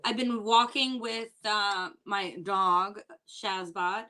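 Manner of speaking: usual speaking voice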